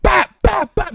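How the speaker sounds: high, loud, percussive hits; loud comic-book punch sounds; shouted punch noises. Retold or imitated. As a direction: act out rapid blows with the voice. Imitating punches landing.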